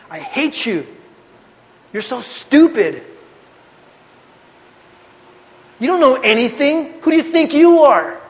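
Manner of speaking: hammed-up bratty yelling at mom and dad; imitating a rebellious teen.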